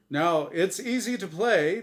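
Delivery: booming, bright host voice; game show host. Acted. The angel Azrael hosting his show for Norm, big host voice. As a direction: sell it like a TV quiz host.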